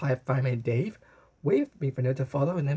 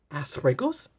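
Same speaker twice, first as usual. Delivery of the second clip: ordinary delivery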